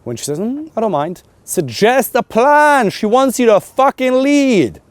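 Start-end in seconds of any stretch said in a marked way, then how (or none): 0.4-1.2 high, noncommittal put-on voice; imitated indecisive woman. Voicing an undecided woman. 1.4-4.8 high, loud, drawn-out command; emphatic exhortation. Real, not acted. Coach exhorting listeners emphatically.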